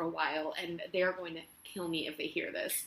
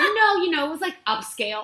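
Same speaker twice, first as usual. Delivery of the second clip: flustered insistence; 9 semitones higher, 10 dB louder than usual. Retold or imitated. Retold flustered interview answer.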